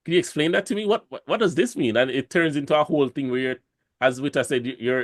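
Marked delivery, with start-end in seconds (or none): 0.0-1.9 puzzled foreigner. Retold or imitated. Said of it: "It's like".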